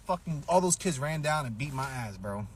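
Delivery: ordinary talk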